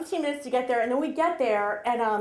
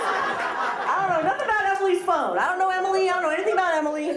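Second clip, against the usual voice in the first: higher, laughing mock innocence; mock denial; 5 semitones higher than usual. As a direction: parody his fake ignorance, amused. Mocking the kid's fake denial.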